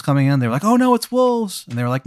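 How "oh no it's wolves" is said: high, drawn-out mock alarm. Villagers seeing the wolves come, in high mock alarm. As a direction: draw out a high pretend panic.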